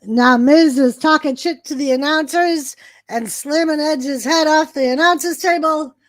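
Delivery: ordinary talk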